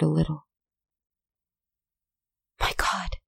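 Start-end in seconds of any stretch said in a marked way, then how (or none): 2.6-3.2 hushed breathless whisper. Acted. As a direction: breathe it out in shock. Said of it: "she whispered".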